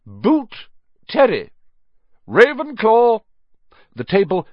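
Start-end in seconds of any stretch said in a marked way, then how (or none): 0.1-1.5 high, loud name call; projected, high-pitched register call; roll call. A narrator's reading. Teacher calls the roll. 2.3-3.2 high, loud, ringing shout; shouted announcement. A narrator's reading. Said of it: "shouted the hat again".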